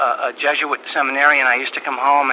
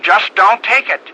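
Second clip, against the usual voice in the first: "Just don't take it" said higher, louder and slow, with the weight on "take"; insistent refusal; 5 semitones higher, 4 dB louder, slower than usual. Retold or imitated. Father refusing to let his wife take the job, stern and insistent. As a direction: lay down the law, slowly and firmly. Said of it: "He said".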